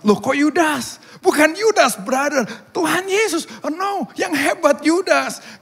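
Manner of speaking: high, loud disbelief at their answer; incredulous protest. Acted. Missionary disputes villagers' choice of hero, incredulous protest. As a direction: exclaim it high, correcting a baffling mistake.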